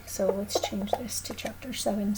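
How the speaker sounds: a whispered correction to herself; whispered aside. Real, not acted. Teacher correcting the board while whispering to herself. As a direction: whisper it under your breath. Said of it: "whispered correction".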